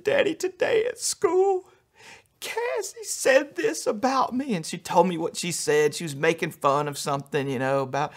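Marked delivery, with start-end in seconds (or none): 0.0-4.5 put-on much higher, slow, tearful child voice; crying little girl voice. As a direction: voice a hurt little girl through tears. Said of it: "she just burst into tears".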